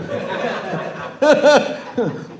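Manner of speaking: high airy burst of laughter; breathless real laugh. Real, not acted. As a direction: laugh at the absurdity of it. Laughing at own story.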